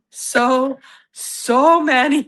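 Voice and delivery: high, drawn-out so many; exaggerated so many; emphatic. Real, not acted. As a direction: stretch it out in disbelief. Emphatic agreement about Boilermakers.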